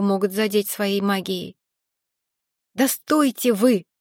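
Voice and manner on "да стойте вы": yelling. Acted. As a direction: yell it to stop the fight. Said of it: "заорала я".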